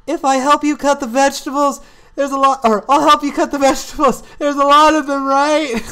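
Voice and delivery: high, loud put-on voice; character voice. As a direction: commit to a goofy guy voice. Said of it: "the voice I got for this guy".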